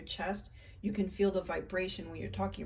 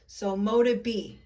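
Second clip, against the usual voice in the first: So mote it be, said much louder, demanding; loud and firm; 2 semitones higher, 10 dB louder than usual. Shown as a demonstration. Finishing her own incantation, loud and commanding. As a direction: command it into being. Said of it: "I'm going to say".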